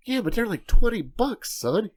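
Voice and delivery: high, startled objection to the price; mock protest. Dad to son over twenty-dollar meatballs, high mock protest. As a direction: balk at the cost.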